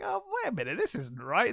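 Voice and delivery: sudden high squeak, then back down; a jumpy little clerk spotting a flaw; fussy guard. Retold or imitated. Fed-up guard checks papers.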